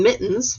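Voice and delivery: "mittens" with a long humming M; stressed first sound. Shown as a demonstration. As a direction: hold the M at the start of "mittens". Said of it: "begins with that M".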